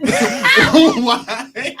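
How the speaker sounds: laughing high squeal. Retold or imitated. Laughing repeat of quote.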